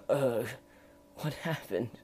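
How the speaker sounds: just-woken, hurting croak; weak croak. A narrator's reading. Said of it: "he croaked".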